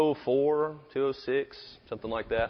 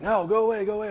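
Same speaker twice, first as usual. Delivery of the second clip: higher-pitched imitation of an annoyed roommate; mock irritated brush-off; dismissive refusal; 9 semitones higher than usual. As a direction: shoo someone off from behind a door. Roommate refusing to open door.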